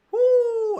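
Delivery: high loud woo; whoop. Real, not acted. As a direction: let out a happy whoop. Remembering good times.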